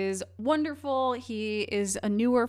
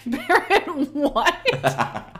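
surprised; 6 semitones higher, 7 dB louder, much slower than usual. Retold or imitated. Host reacting in disbelief.

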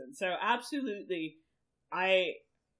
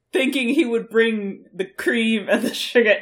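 loud, indignant retelling; loud outraged; 2 semitones higher, 14 dB louder than usual. Real, not acted. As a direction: shout the outrage. Outraged tea story, loud.

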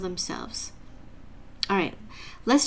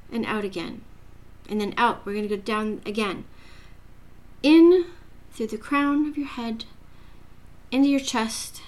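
guided meditation; 3 dB quieter than usual. Acted. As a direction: ease the listener into calm breathing.

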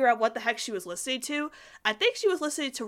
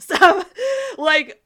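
laughing; 9 semitones higher, 12 dB louder than usual. Real, not acted. Laughs at the show's reference.

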